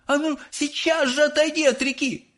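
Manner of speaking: very high scolding mother; mother scolding her son by the river; mother yelling. Acted. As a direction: yell at your disobedient son.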